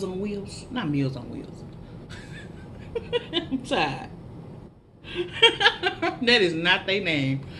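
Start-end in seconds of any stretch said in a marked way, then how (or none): 1.7-3.3 breathy laugh. Real, not acted. Laughs at own mistake. 5.0-6.2 high laugh. Real, not acted. Laughs at own mistake.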